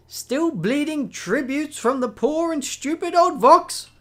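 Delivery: high, loud sneering tease; loud playful insult; mocking jab. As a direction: needle him with a grin in the voice. NPC mocking rival.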